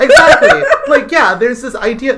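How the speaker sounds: laughing high and loud. Real, not acted. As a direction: burst out laughing. Laughing at the joke.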